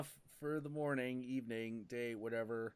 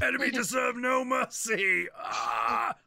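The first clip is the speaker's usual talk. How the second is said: hammed-up stern sensei shout, higher and louder; martial arts sensei; 9 semitones higher, 8 dB louder than usual. Movie karate master voiced for friends, mock fierce shout. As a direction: bark the dojo motto then a battle yell. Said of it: "kinda like Mister Miyagi".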